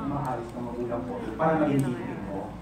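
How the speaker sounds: very low, softened voice; wounded, low. Acted. Partner denying being a victimizer, low and subdued. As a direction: drop right down, hurt and subdued. Wounded answer in breakup scene.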